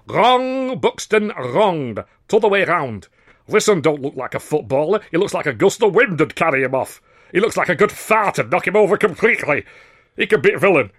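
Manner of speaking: high, loud, coarse mockery in broad Lancashire; blustering northern bully. A narrator's reading. Star comic overrules the manager, loud and mocking. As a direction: bark him down and sneer at the newcomer.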